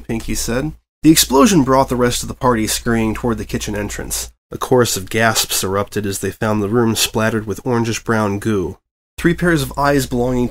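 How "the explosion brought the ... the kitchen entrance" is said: ordinary delivery, nothing marked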